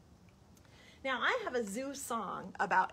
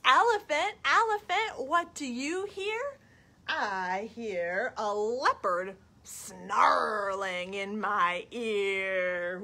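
put-on reading to children; 4 dB louder, much slower than usual. Storytime question and answer about a leopard, dramatic. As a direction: draw out SNARLING in the answer. Reads picture book to children.

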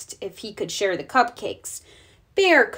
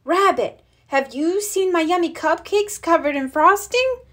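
higher, urgent questioning; frantic question; 8 semitones higher than usual. Acted. Bear asking Rabbit about missing cupcakes, anxious. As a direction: demand an answer in a hurry.

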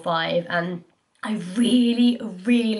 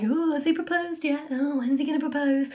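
higher, eager prying voice; pry like an eager auntie; nosy relatives; 7 semitones higher than usual. Retold or imitated. Friends and family grabbing her hand, higher eager nosy questioning. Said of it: "everybody grabs my hand and they're like".

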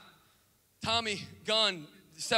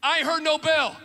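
louder, higher defiant taunt; tough taunt; 7 semitones higher, 6 dB louder than usual. Acted. Rocky taunting Tommy, louder defiant call. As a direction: dare him to keep going. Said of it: "and he goes".

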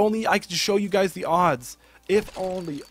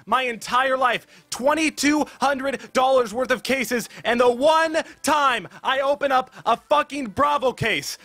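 excited disbelief; 8 semitones higher than usual. Real, not acted. Still reeling from the knife.

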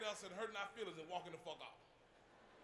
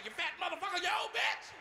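much higher, louder furious yell; angry shout; 8 semitones higher, 4 dB louder than usual. Retold or imitated. The old lady yells insults back in church. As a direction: screech the insults at him. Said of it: "She got mad".

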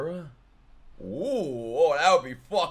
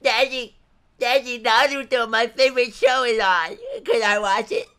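lightly voiced eager kid, a little higher; kid asking daddy; 8 semitones higher, 4 dB louder than usual. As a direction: ask daddy like an excited little boy. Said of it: "Imagine you're watching Cartoon Network".

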